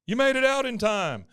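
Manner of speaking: high, loud burst of relief; hammed-up joy at a survivor; amazed mock exclamation. Friend finds Bill alive after the blast, high and elated.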